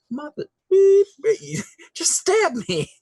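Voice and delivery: very high squealed reaction; reeling back. Acted. Stabbed character reels back, high squealed outburst. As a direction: play a censored curse in a squeaky shock. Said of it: "you reel back like".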